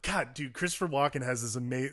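ordinary talk, nothing marked

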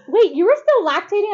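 loud, high incredulous question; retold astonishment. Acting out others' astonished question.

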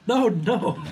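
high-pitched mock protest; mock alarm. Host acting out what was glimpsed beside them, mock alarm. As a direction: jump up high as if warding something off. Acts out own side-vision reaction.

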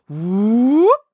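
loud, high upward swoop; rising whoop. Acted. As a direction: slide the voice up loudly.

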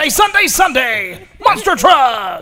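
shouted, slow, high monster truck announcer. Shown as a demonstration. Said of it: "That's his announcer voice".

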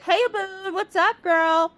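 high-pitched, playful mock greeting; cheery greeting. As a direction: call out to a friend in a high, put-on voice. Cheery sun greets moon.

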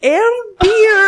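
hammy French read of the label; silly French accent. Pretend. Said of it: "when you're doing the French accent".

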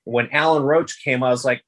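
ordinary talk, nothing marked

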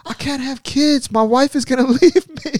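high whiny coach. Retold or imitated. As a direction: whimper it out like a sad coach. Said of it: "He's like".

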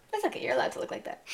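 soft, high, quick little concession; mock indulgent reassurance. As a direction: let him off gently, in play. Acted indulgent reaction to handsomeness.

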